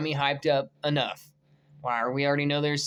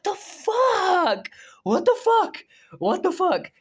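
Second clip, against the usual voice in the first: drawn-out, higher disbelieving cries; stunned disbelief; 13 semitones higher, 4 dB louder, slower than usual. Real, not acted. A fan reading the Mystique reveal, stunned cries. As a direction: stretch the words out in shock.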